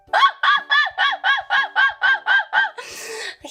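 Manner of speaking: long, high-pitched staccato laugh; laughing fit. Real, not acted. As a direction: crack up in a high rapid-fire cackle. Cracks up at own joke.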